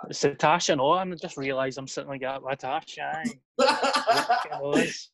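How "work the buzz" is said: laughing. Real, not acted. Giggling repeat of joke.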